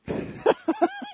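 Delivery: real high-pitched laugh. Real, not acted. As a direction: burst of high giggling. Laughing at own joke.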